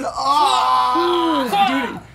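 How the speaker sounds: long, slow, loud pained groan. Real, not acted. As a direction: drag it out in dismay. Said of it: "reaction to the apology".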